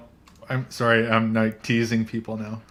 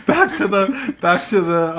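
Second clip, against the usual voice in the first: laughing, higher and louder; 7 semitones higher, 6 dB louder than usual. Real, not acted. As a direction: laugh it off while pulling back on topic. Laughing at viewers leaving.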